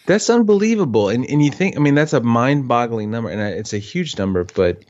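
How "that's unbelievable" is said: surprised. Real, not acted. Reacting to a huge number.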